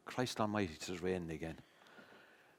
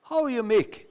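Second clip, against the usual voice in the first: high mock woman's greeting; lightly voiced woman at the door, high; a neighbour calling through the door, high mock woman's voice; 11 semitones higher, 5 dB louder than usual. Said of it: "a woman's voice said".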